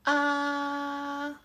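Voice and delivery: "ah" held long, high and flat; first tone. Shown as a demonstration. Teacher shows the first tone, "ah" high and level. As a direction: sustain "ah" on one high, level note. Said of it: "this tone is very flat".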